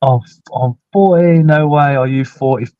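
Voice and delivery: ordinary talk, nothing marked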